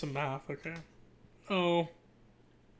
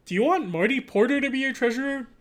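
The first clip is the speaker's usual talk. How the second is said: higher-pitched mock campaign announcer; put-on poster voice; 8 semitones higher, 7 dB louder than usual. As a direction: pitch it up and ask theatrically. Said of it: "why am I doing that voice".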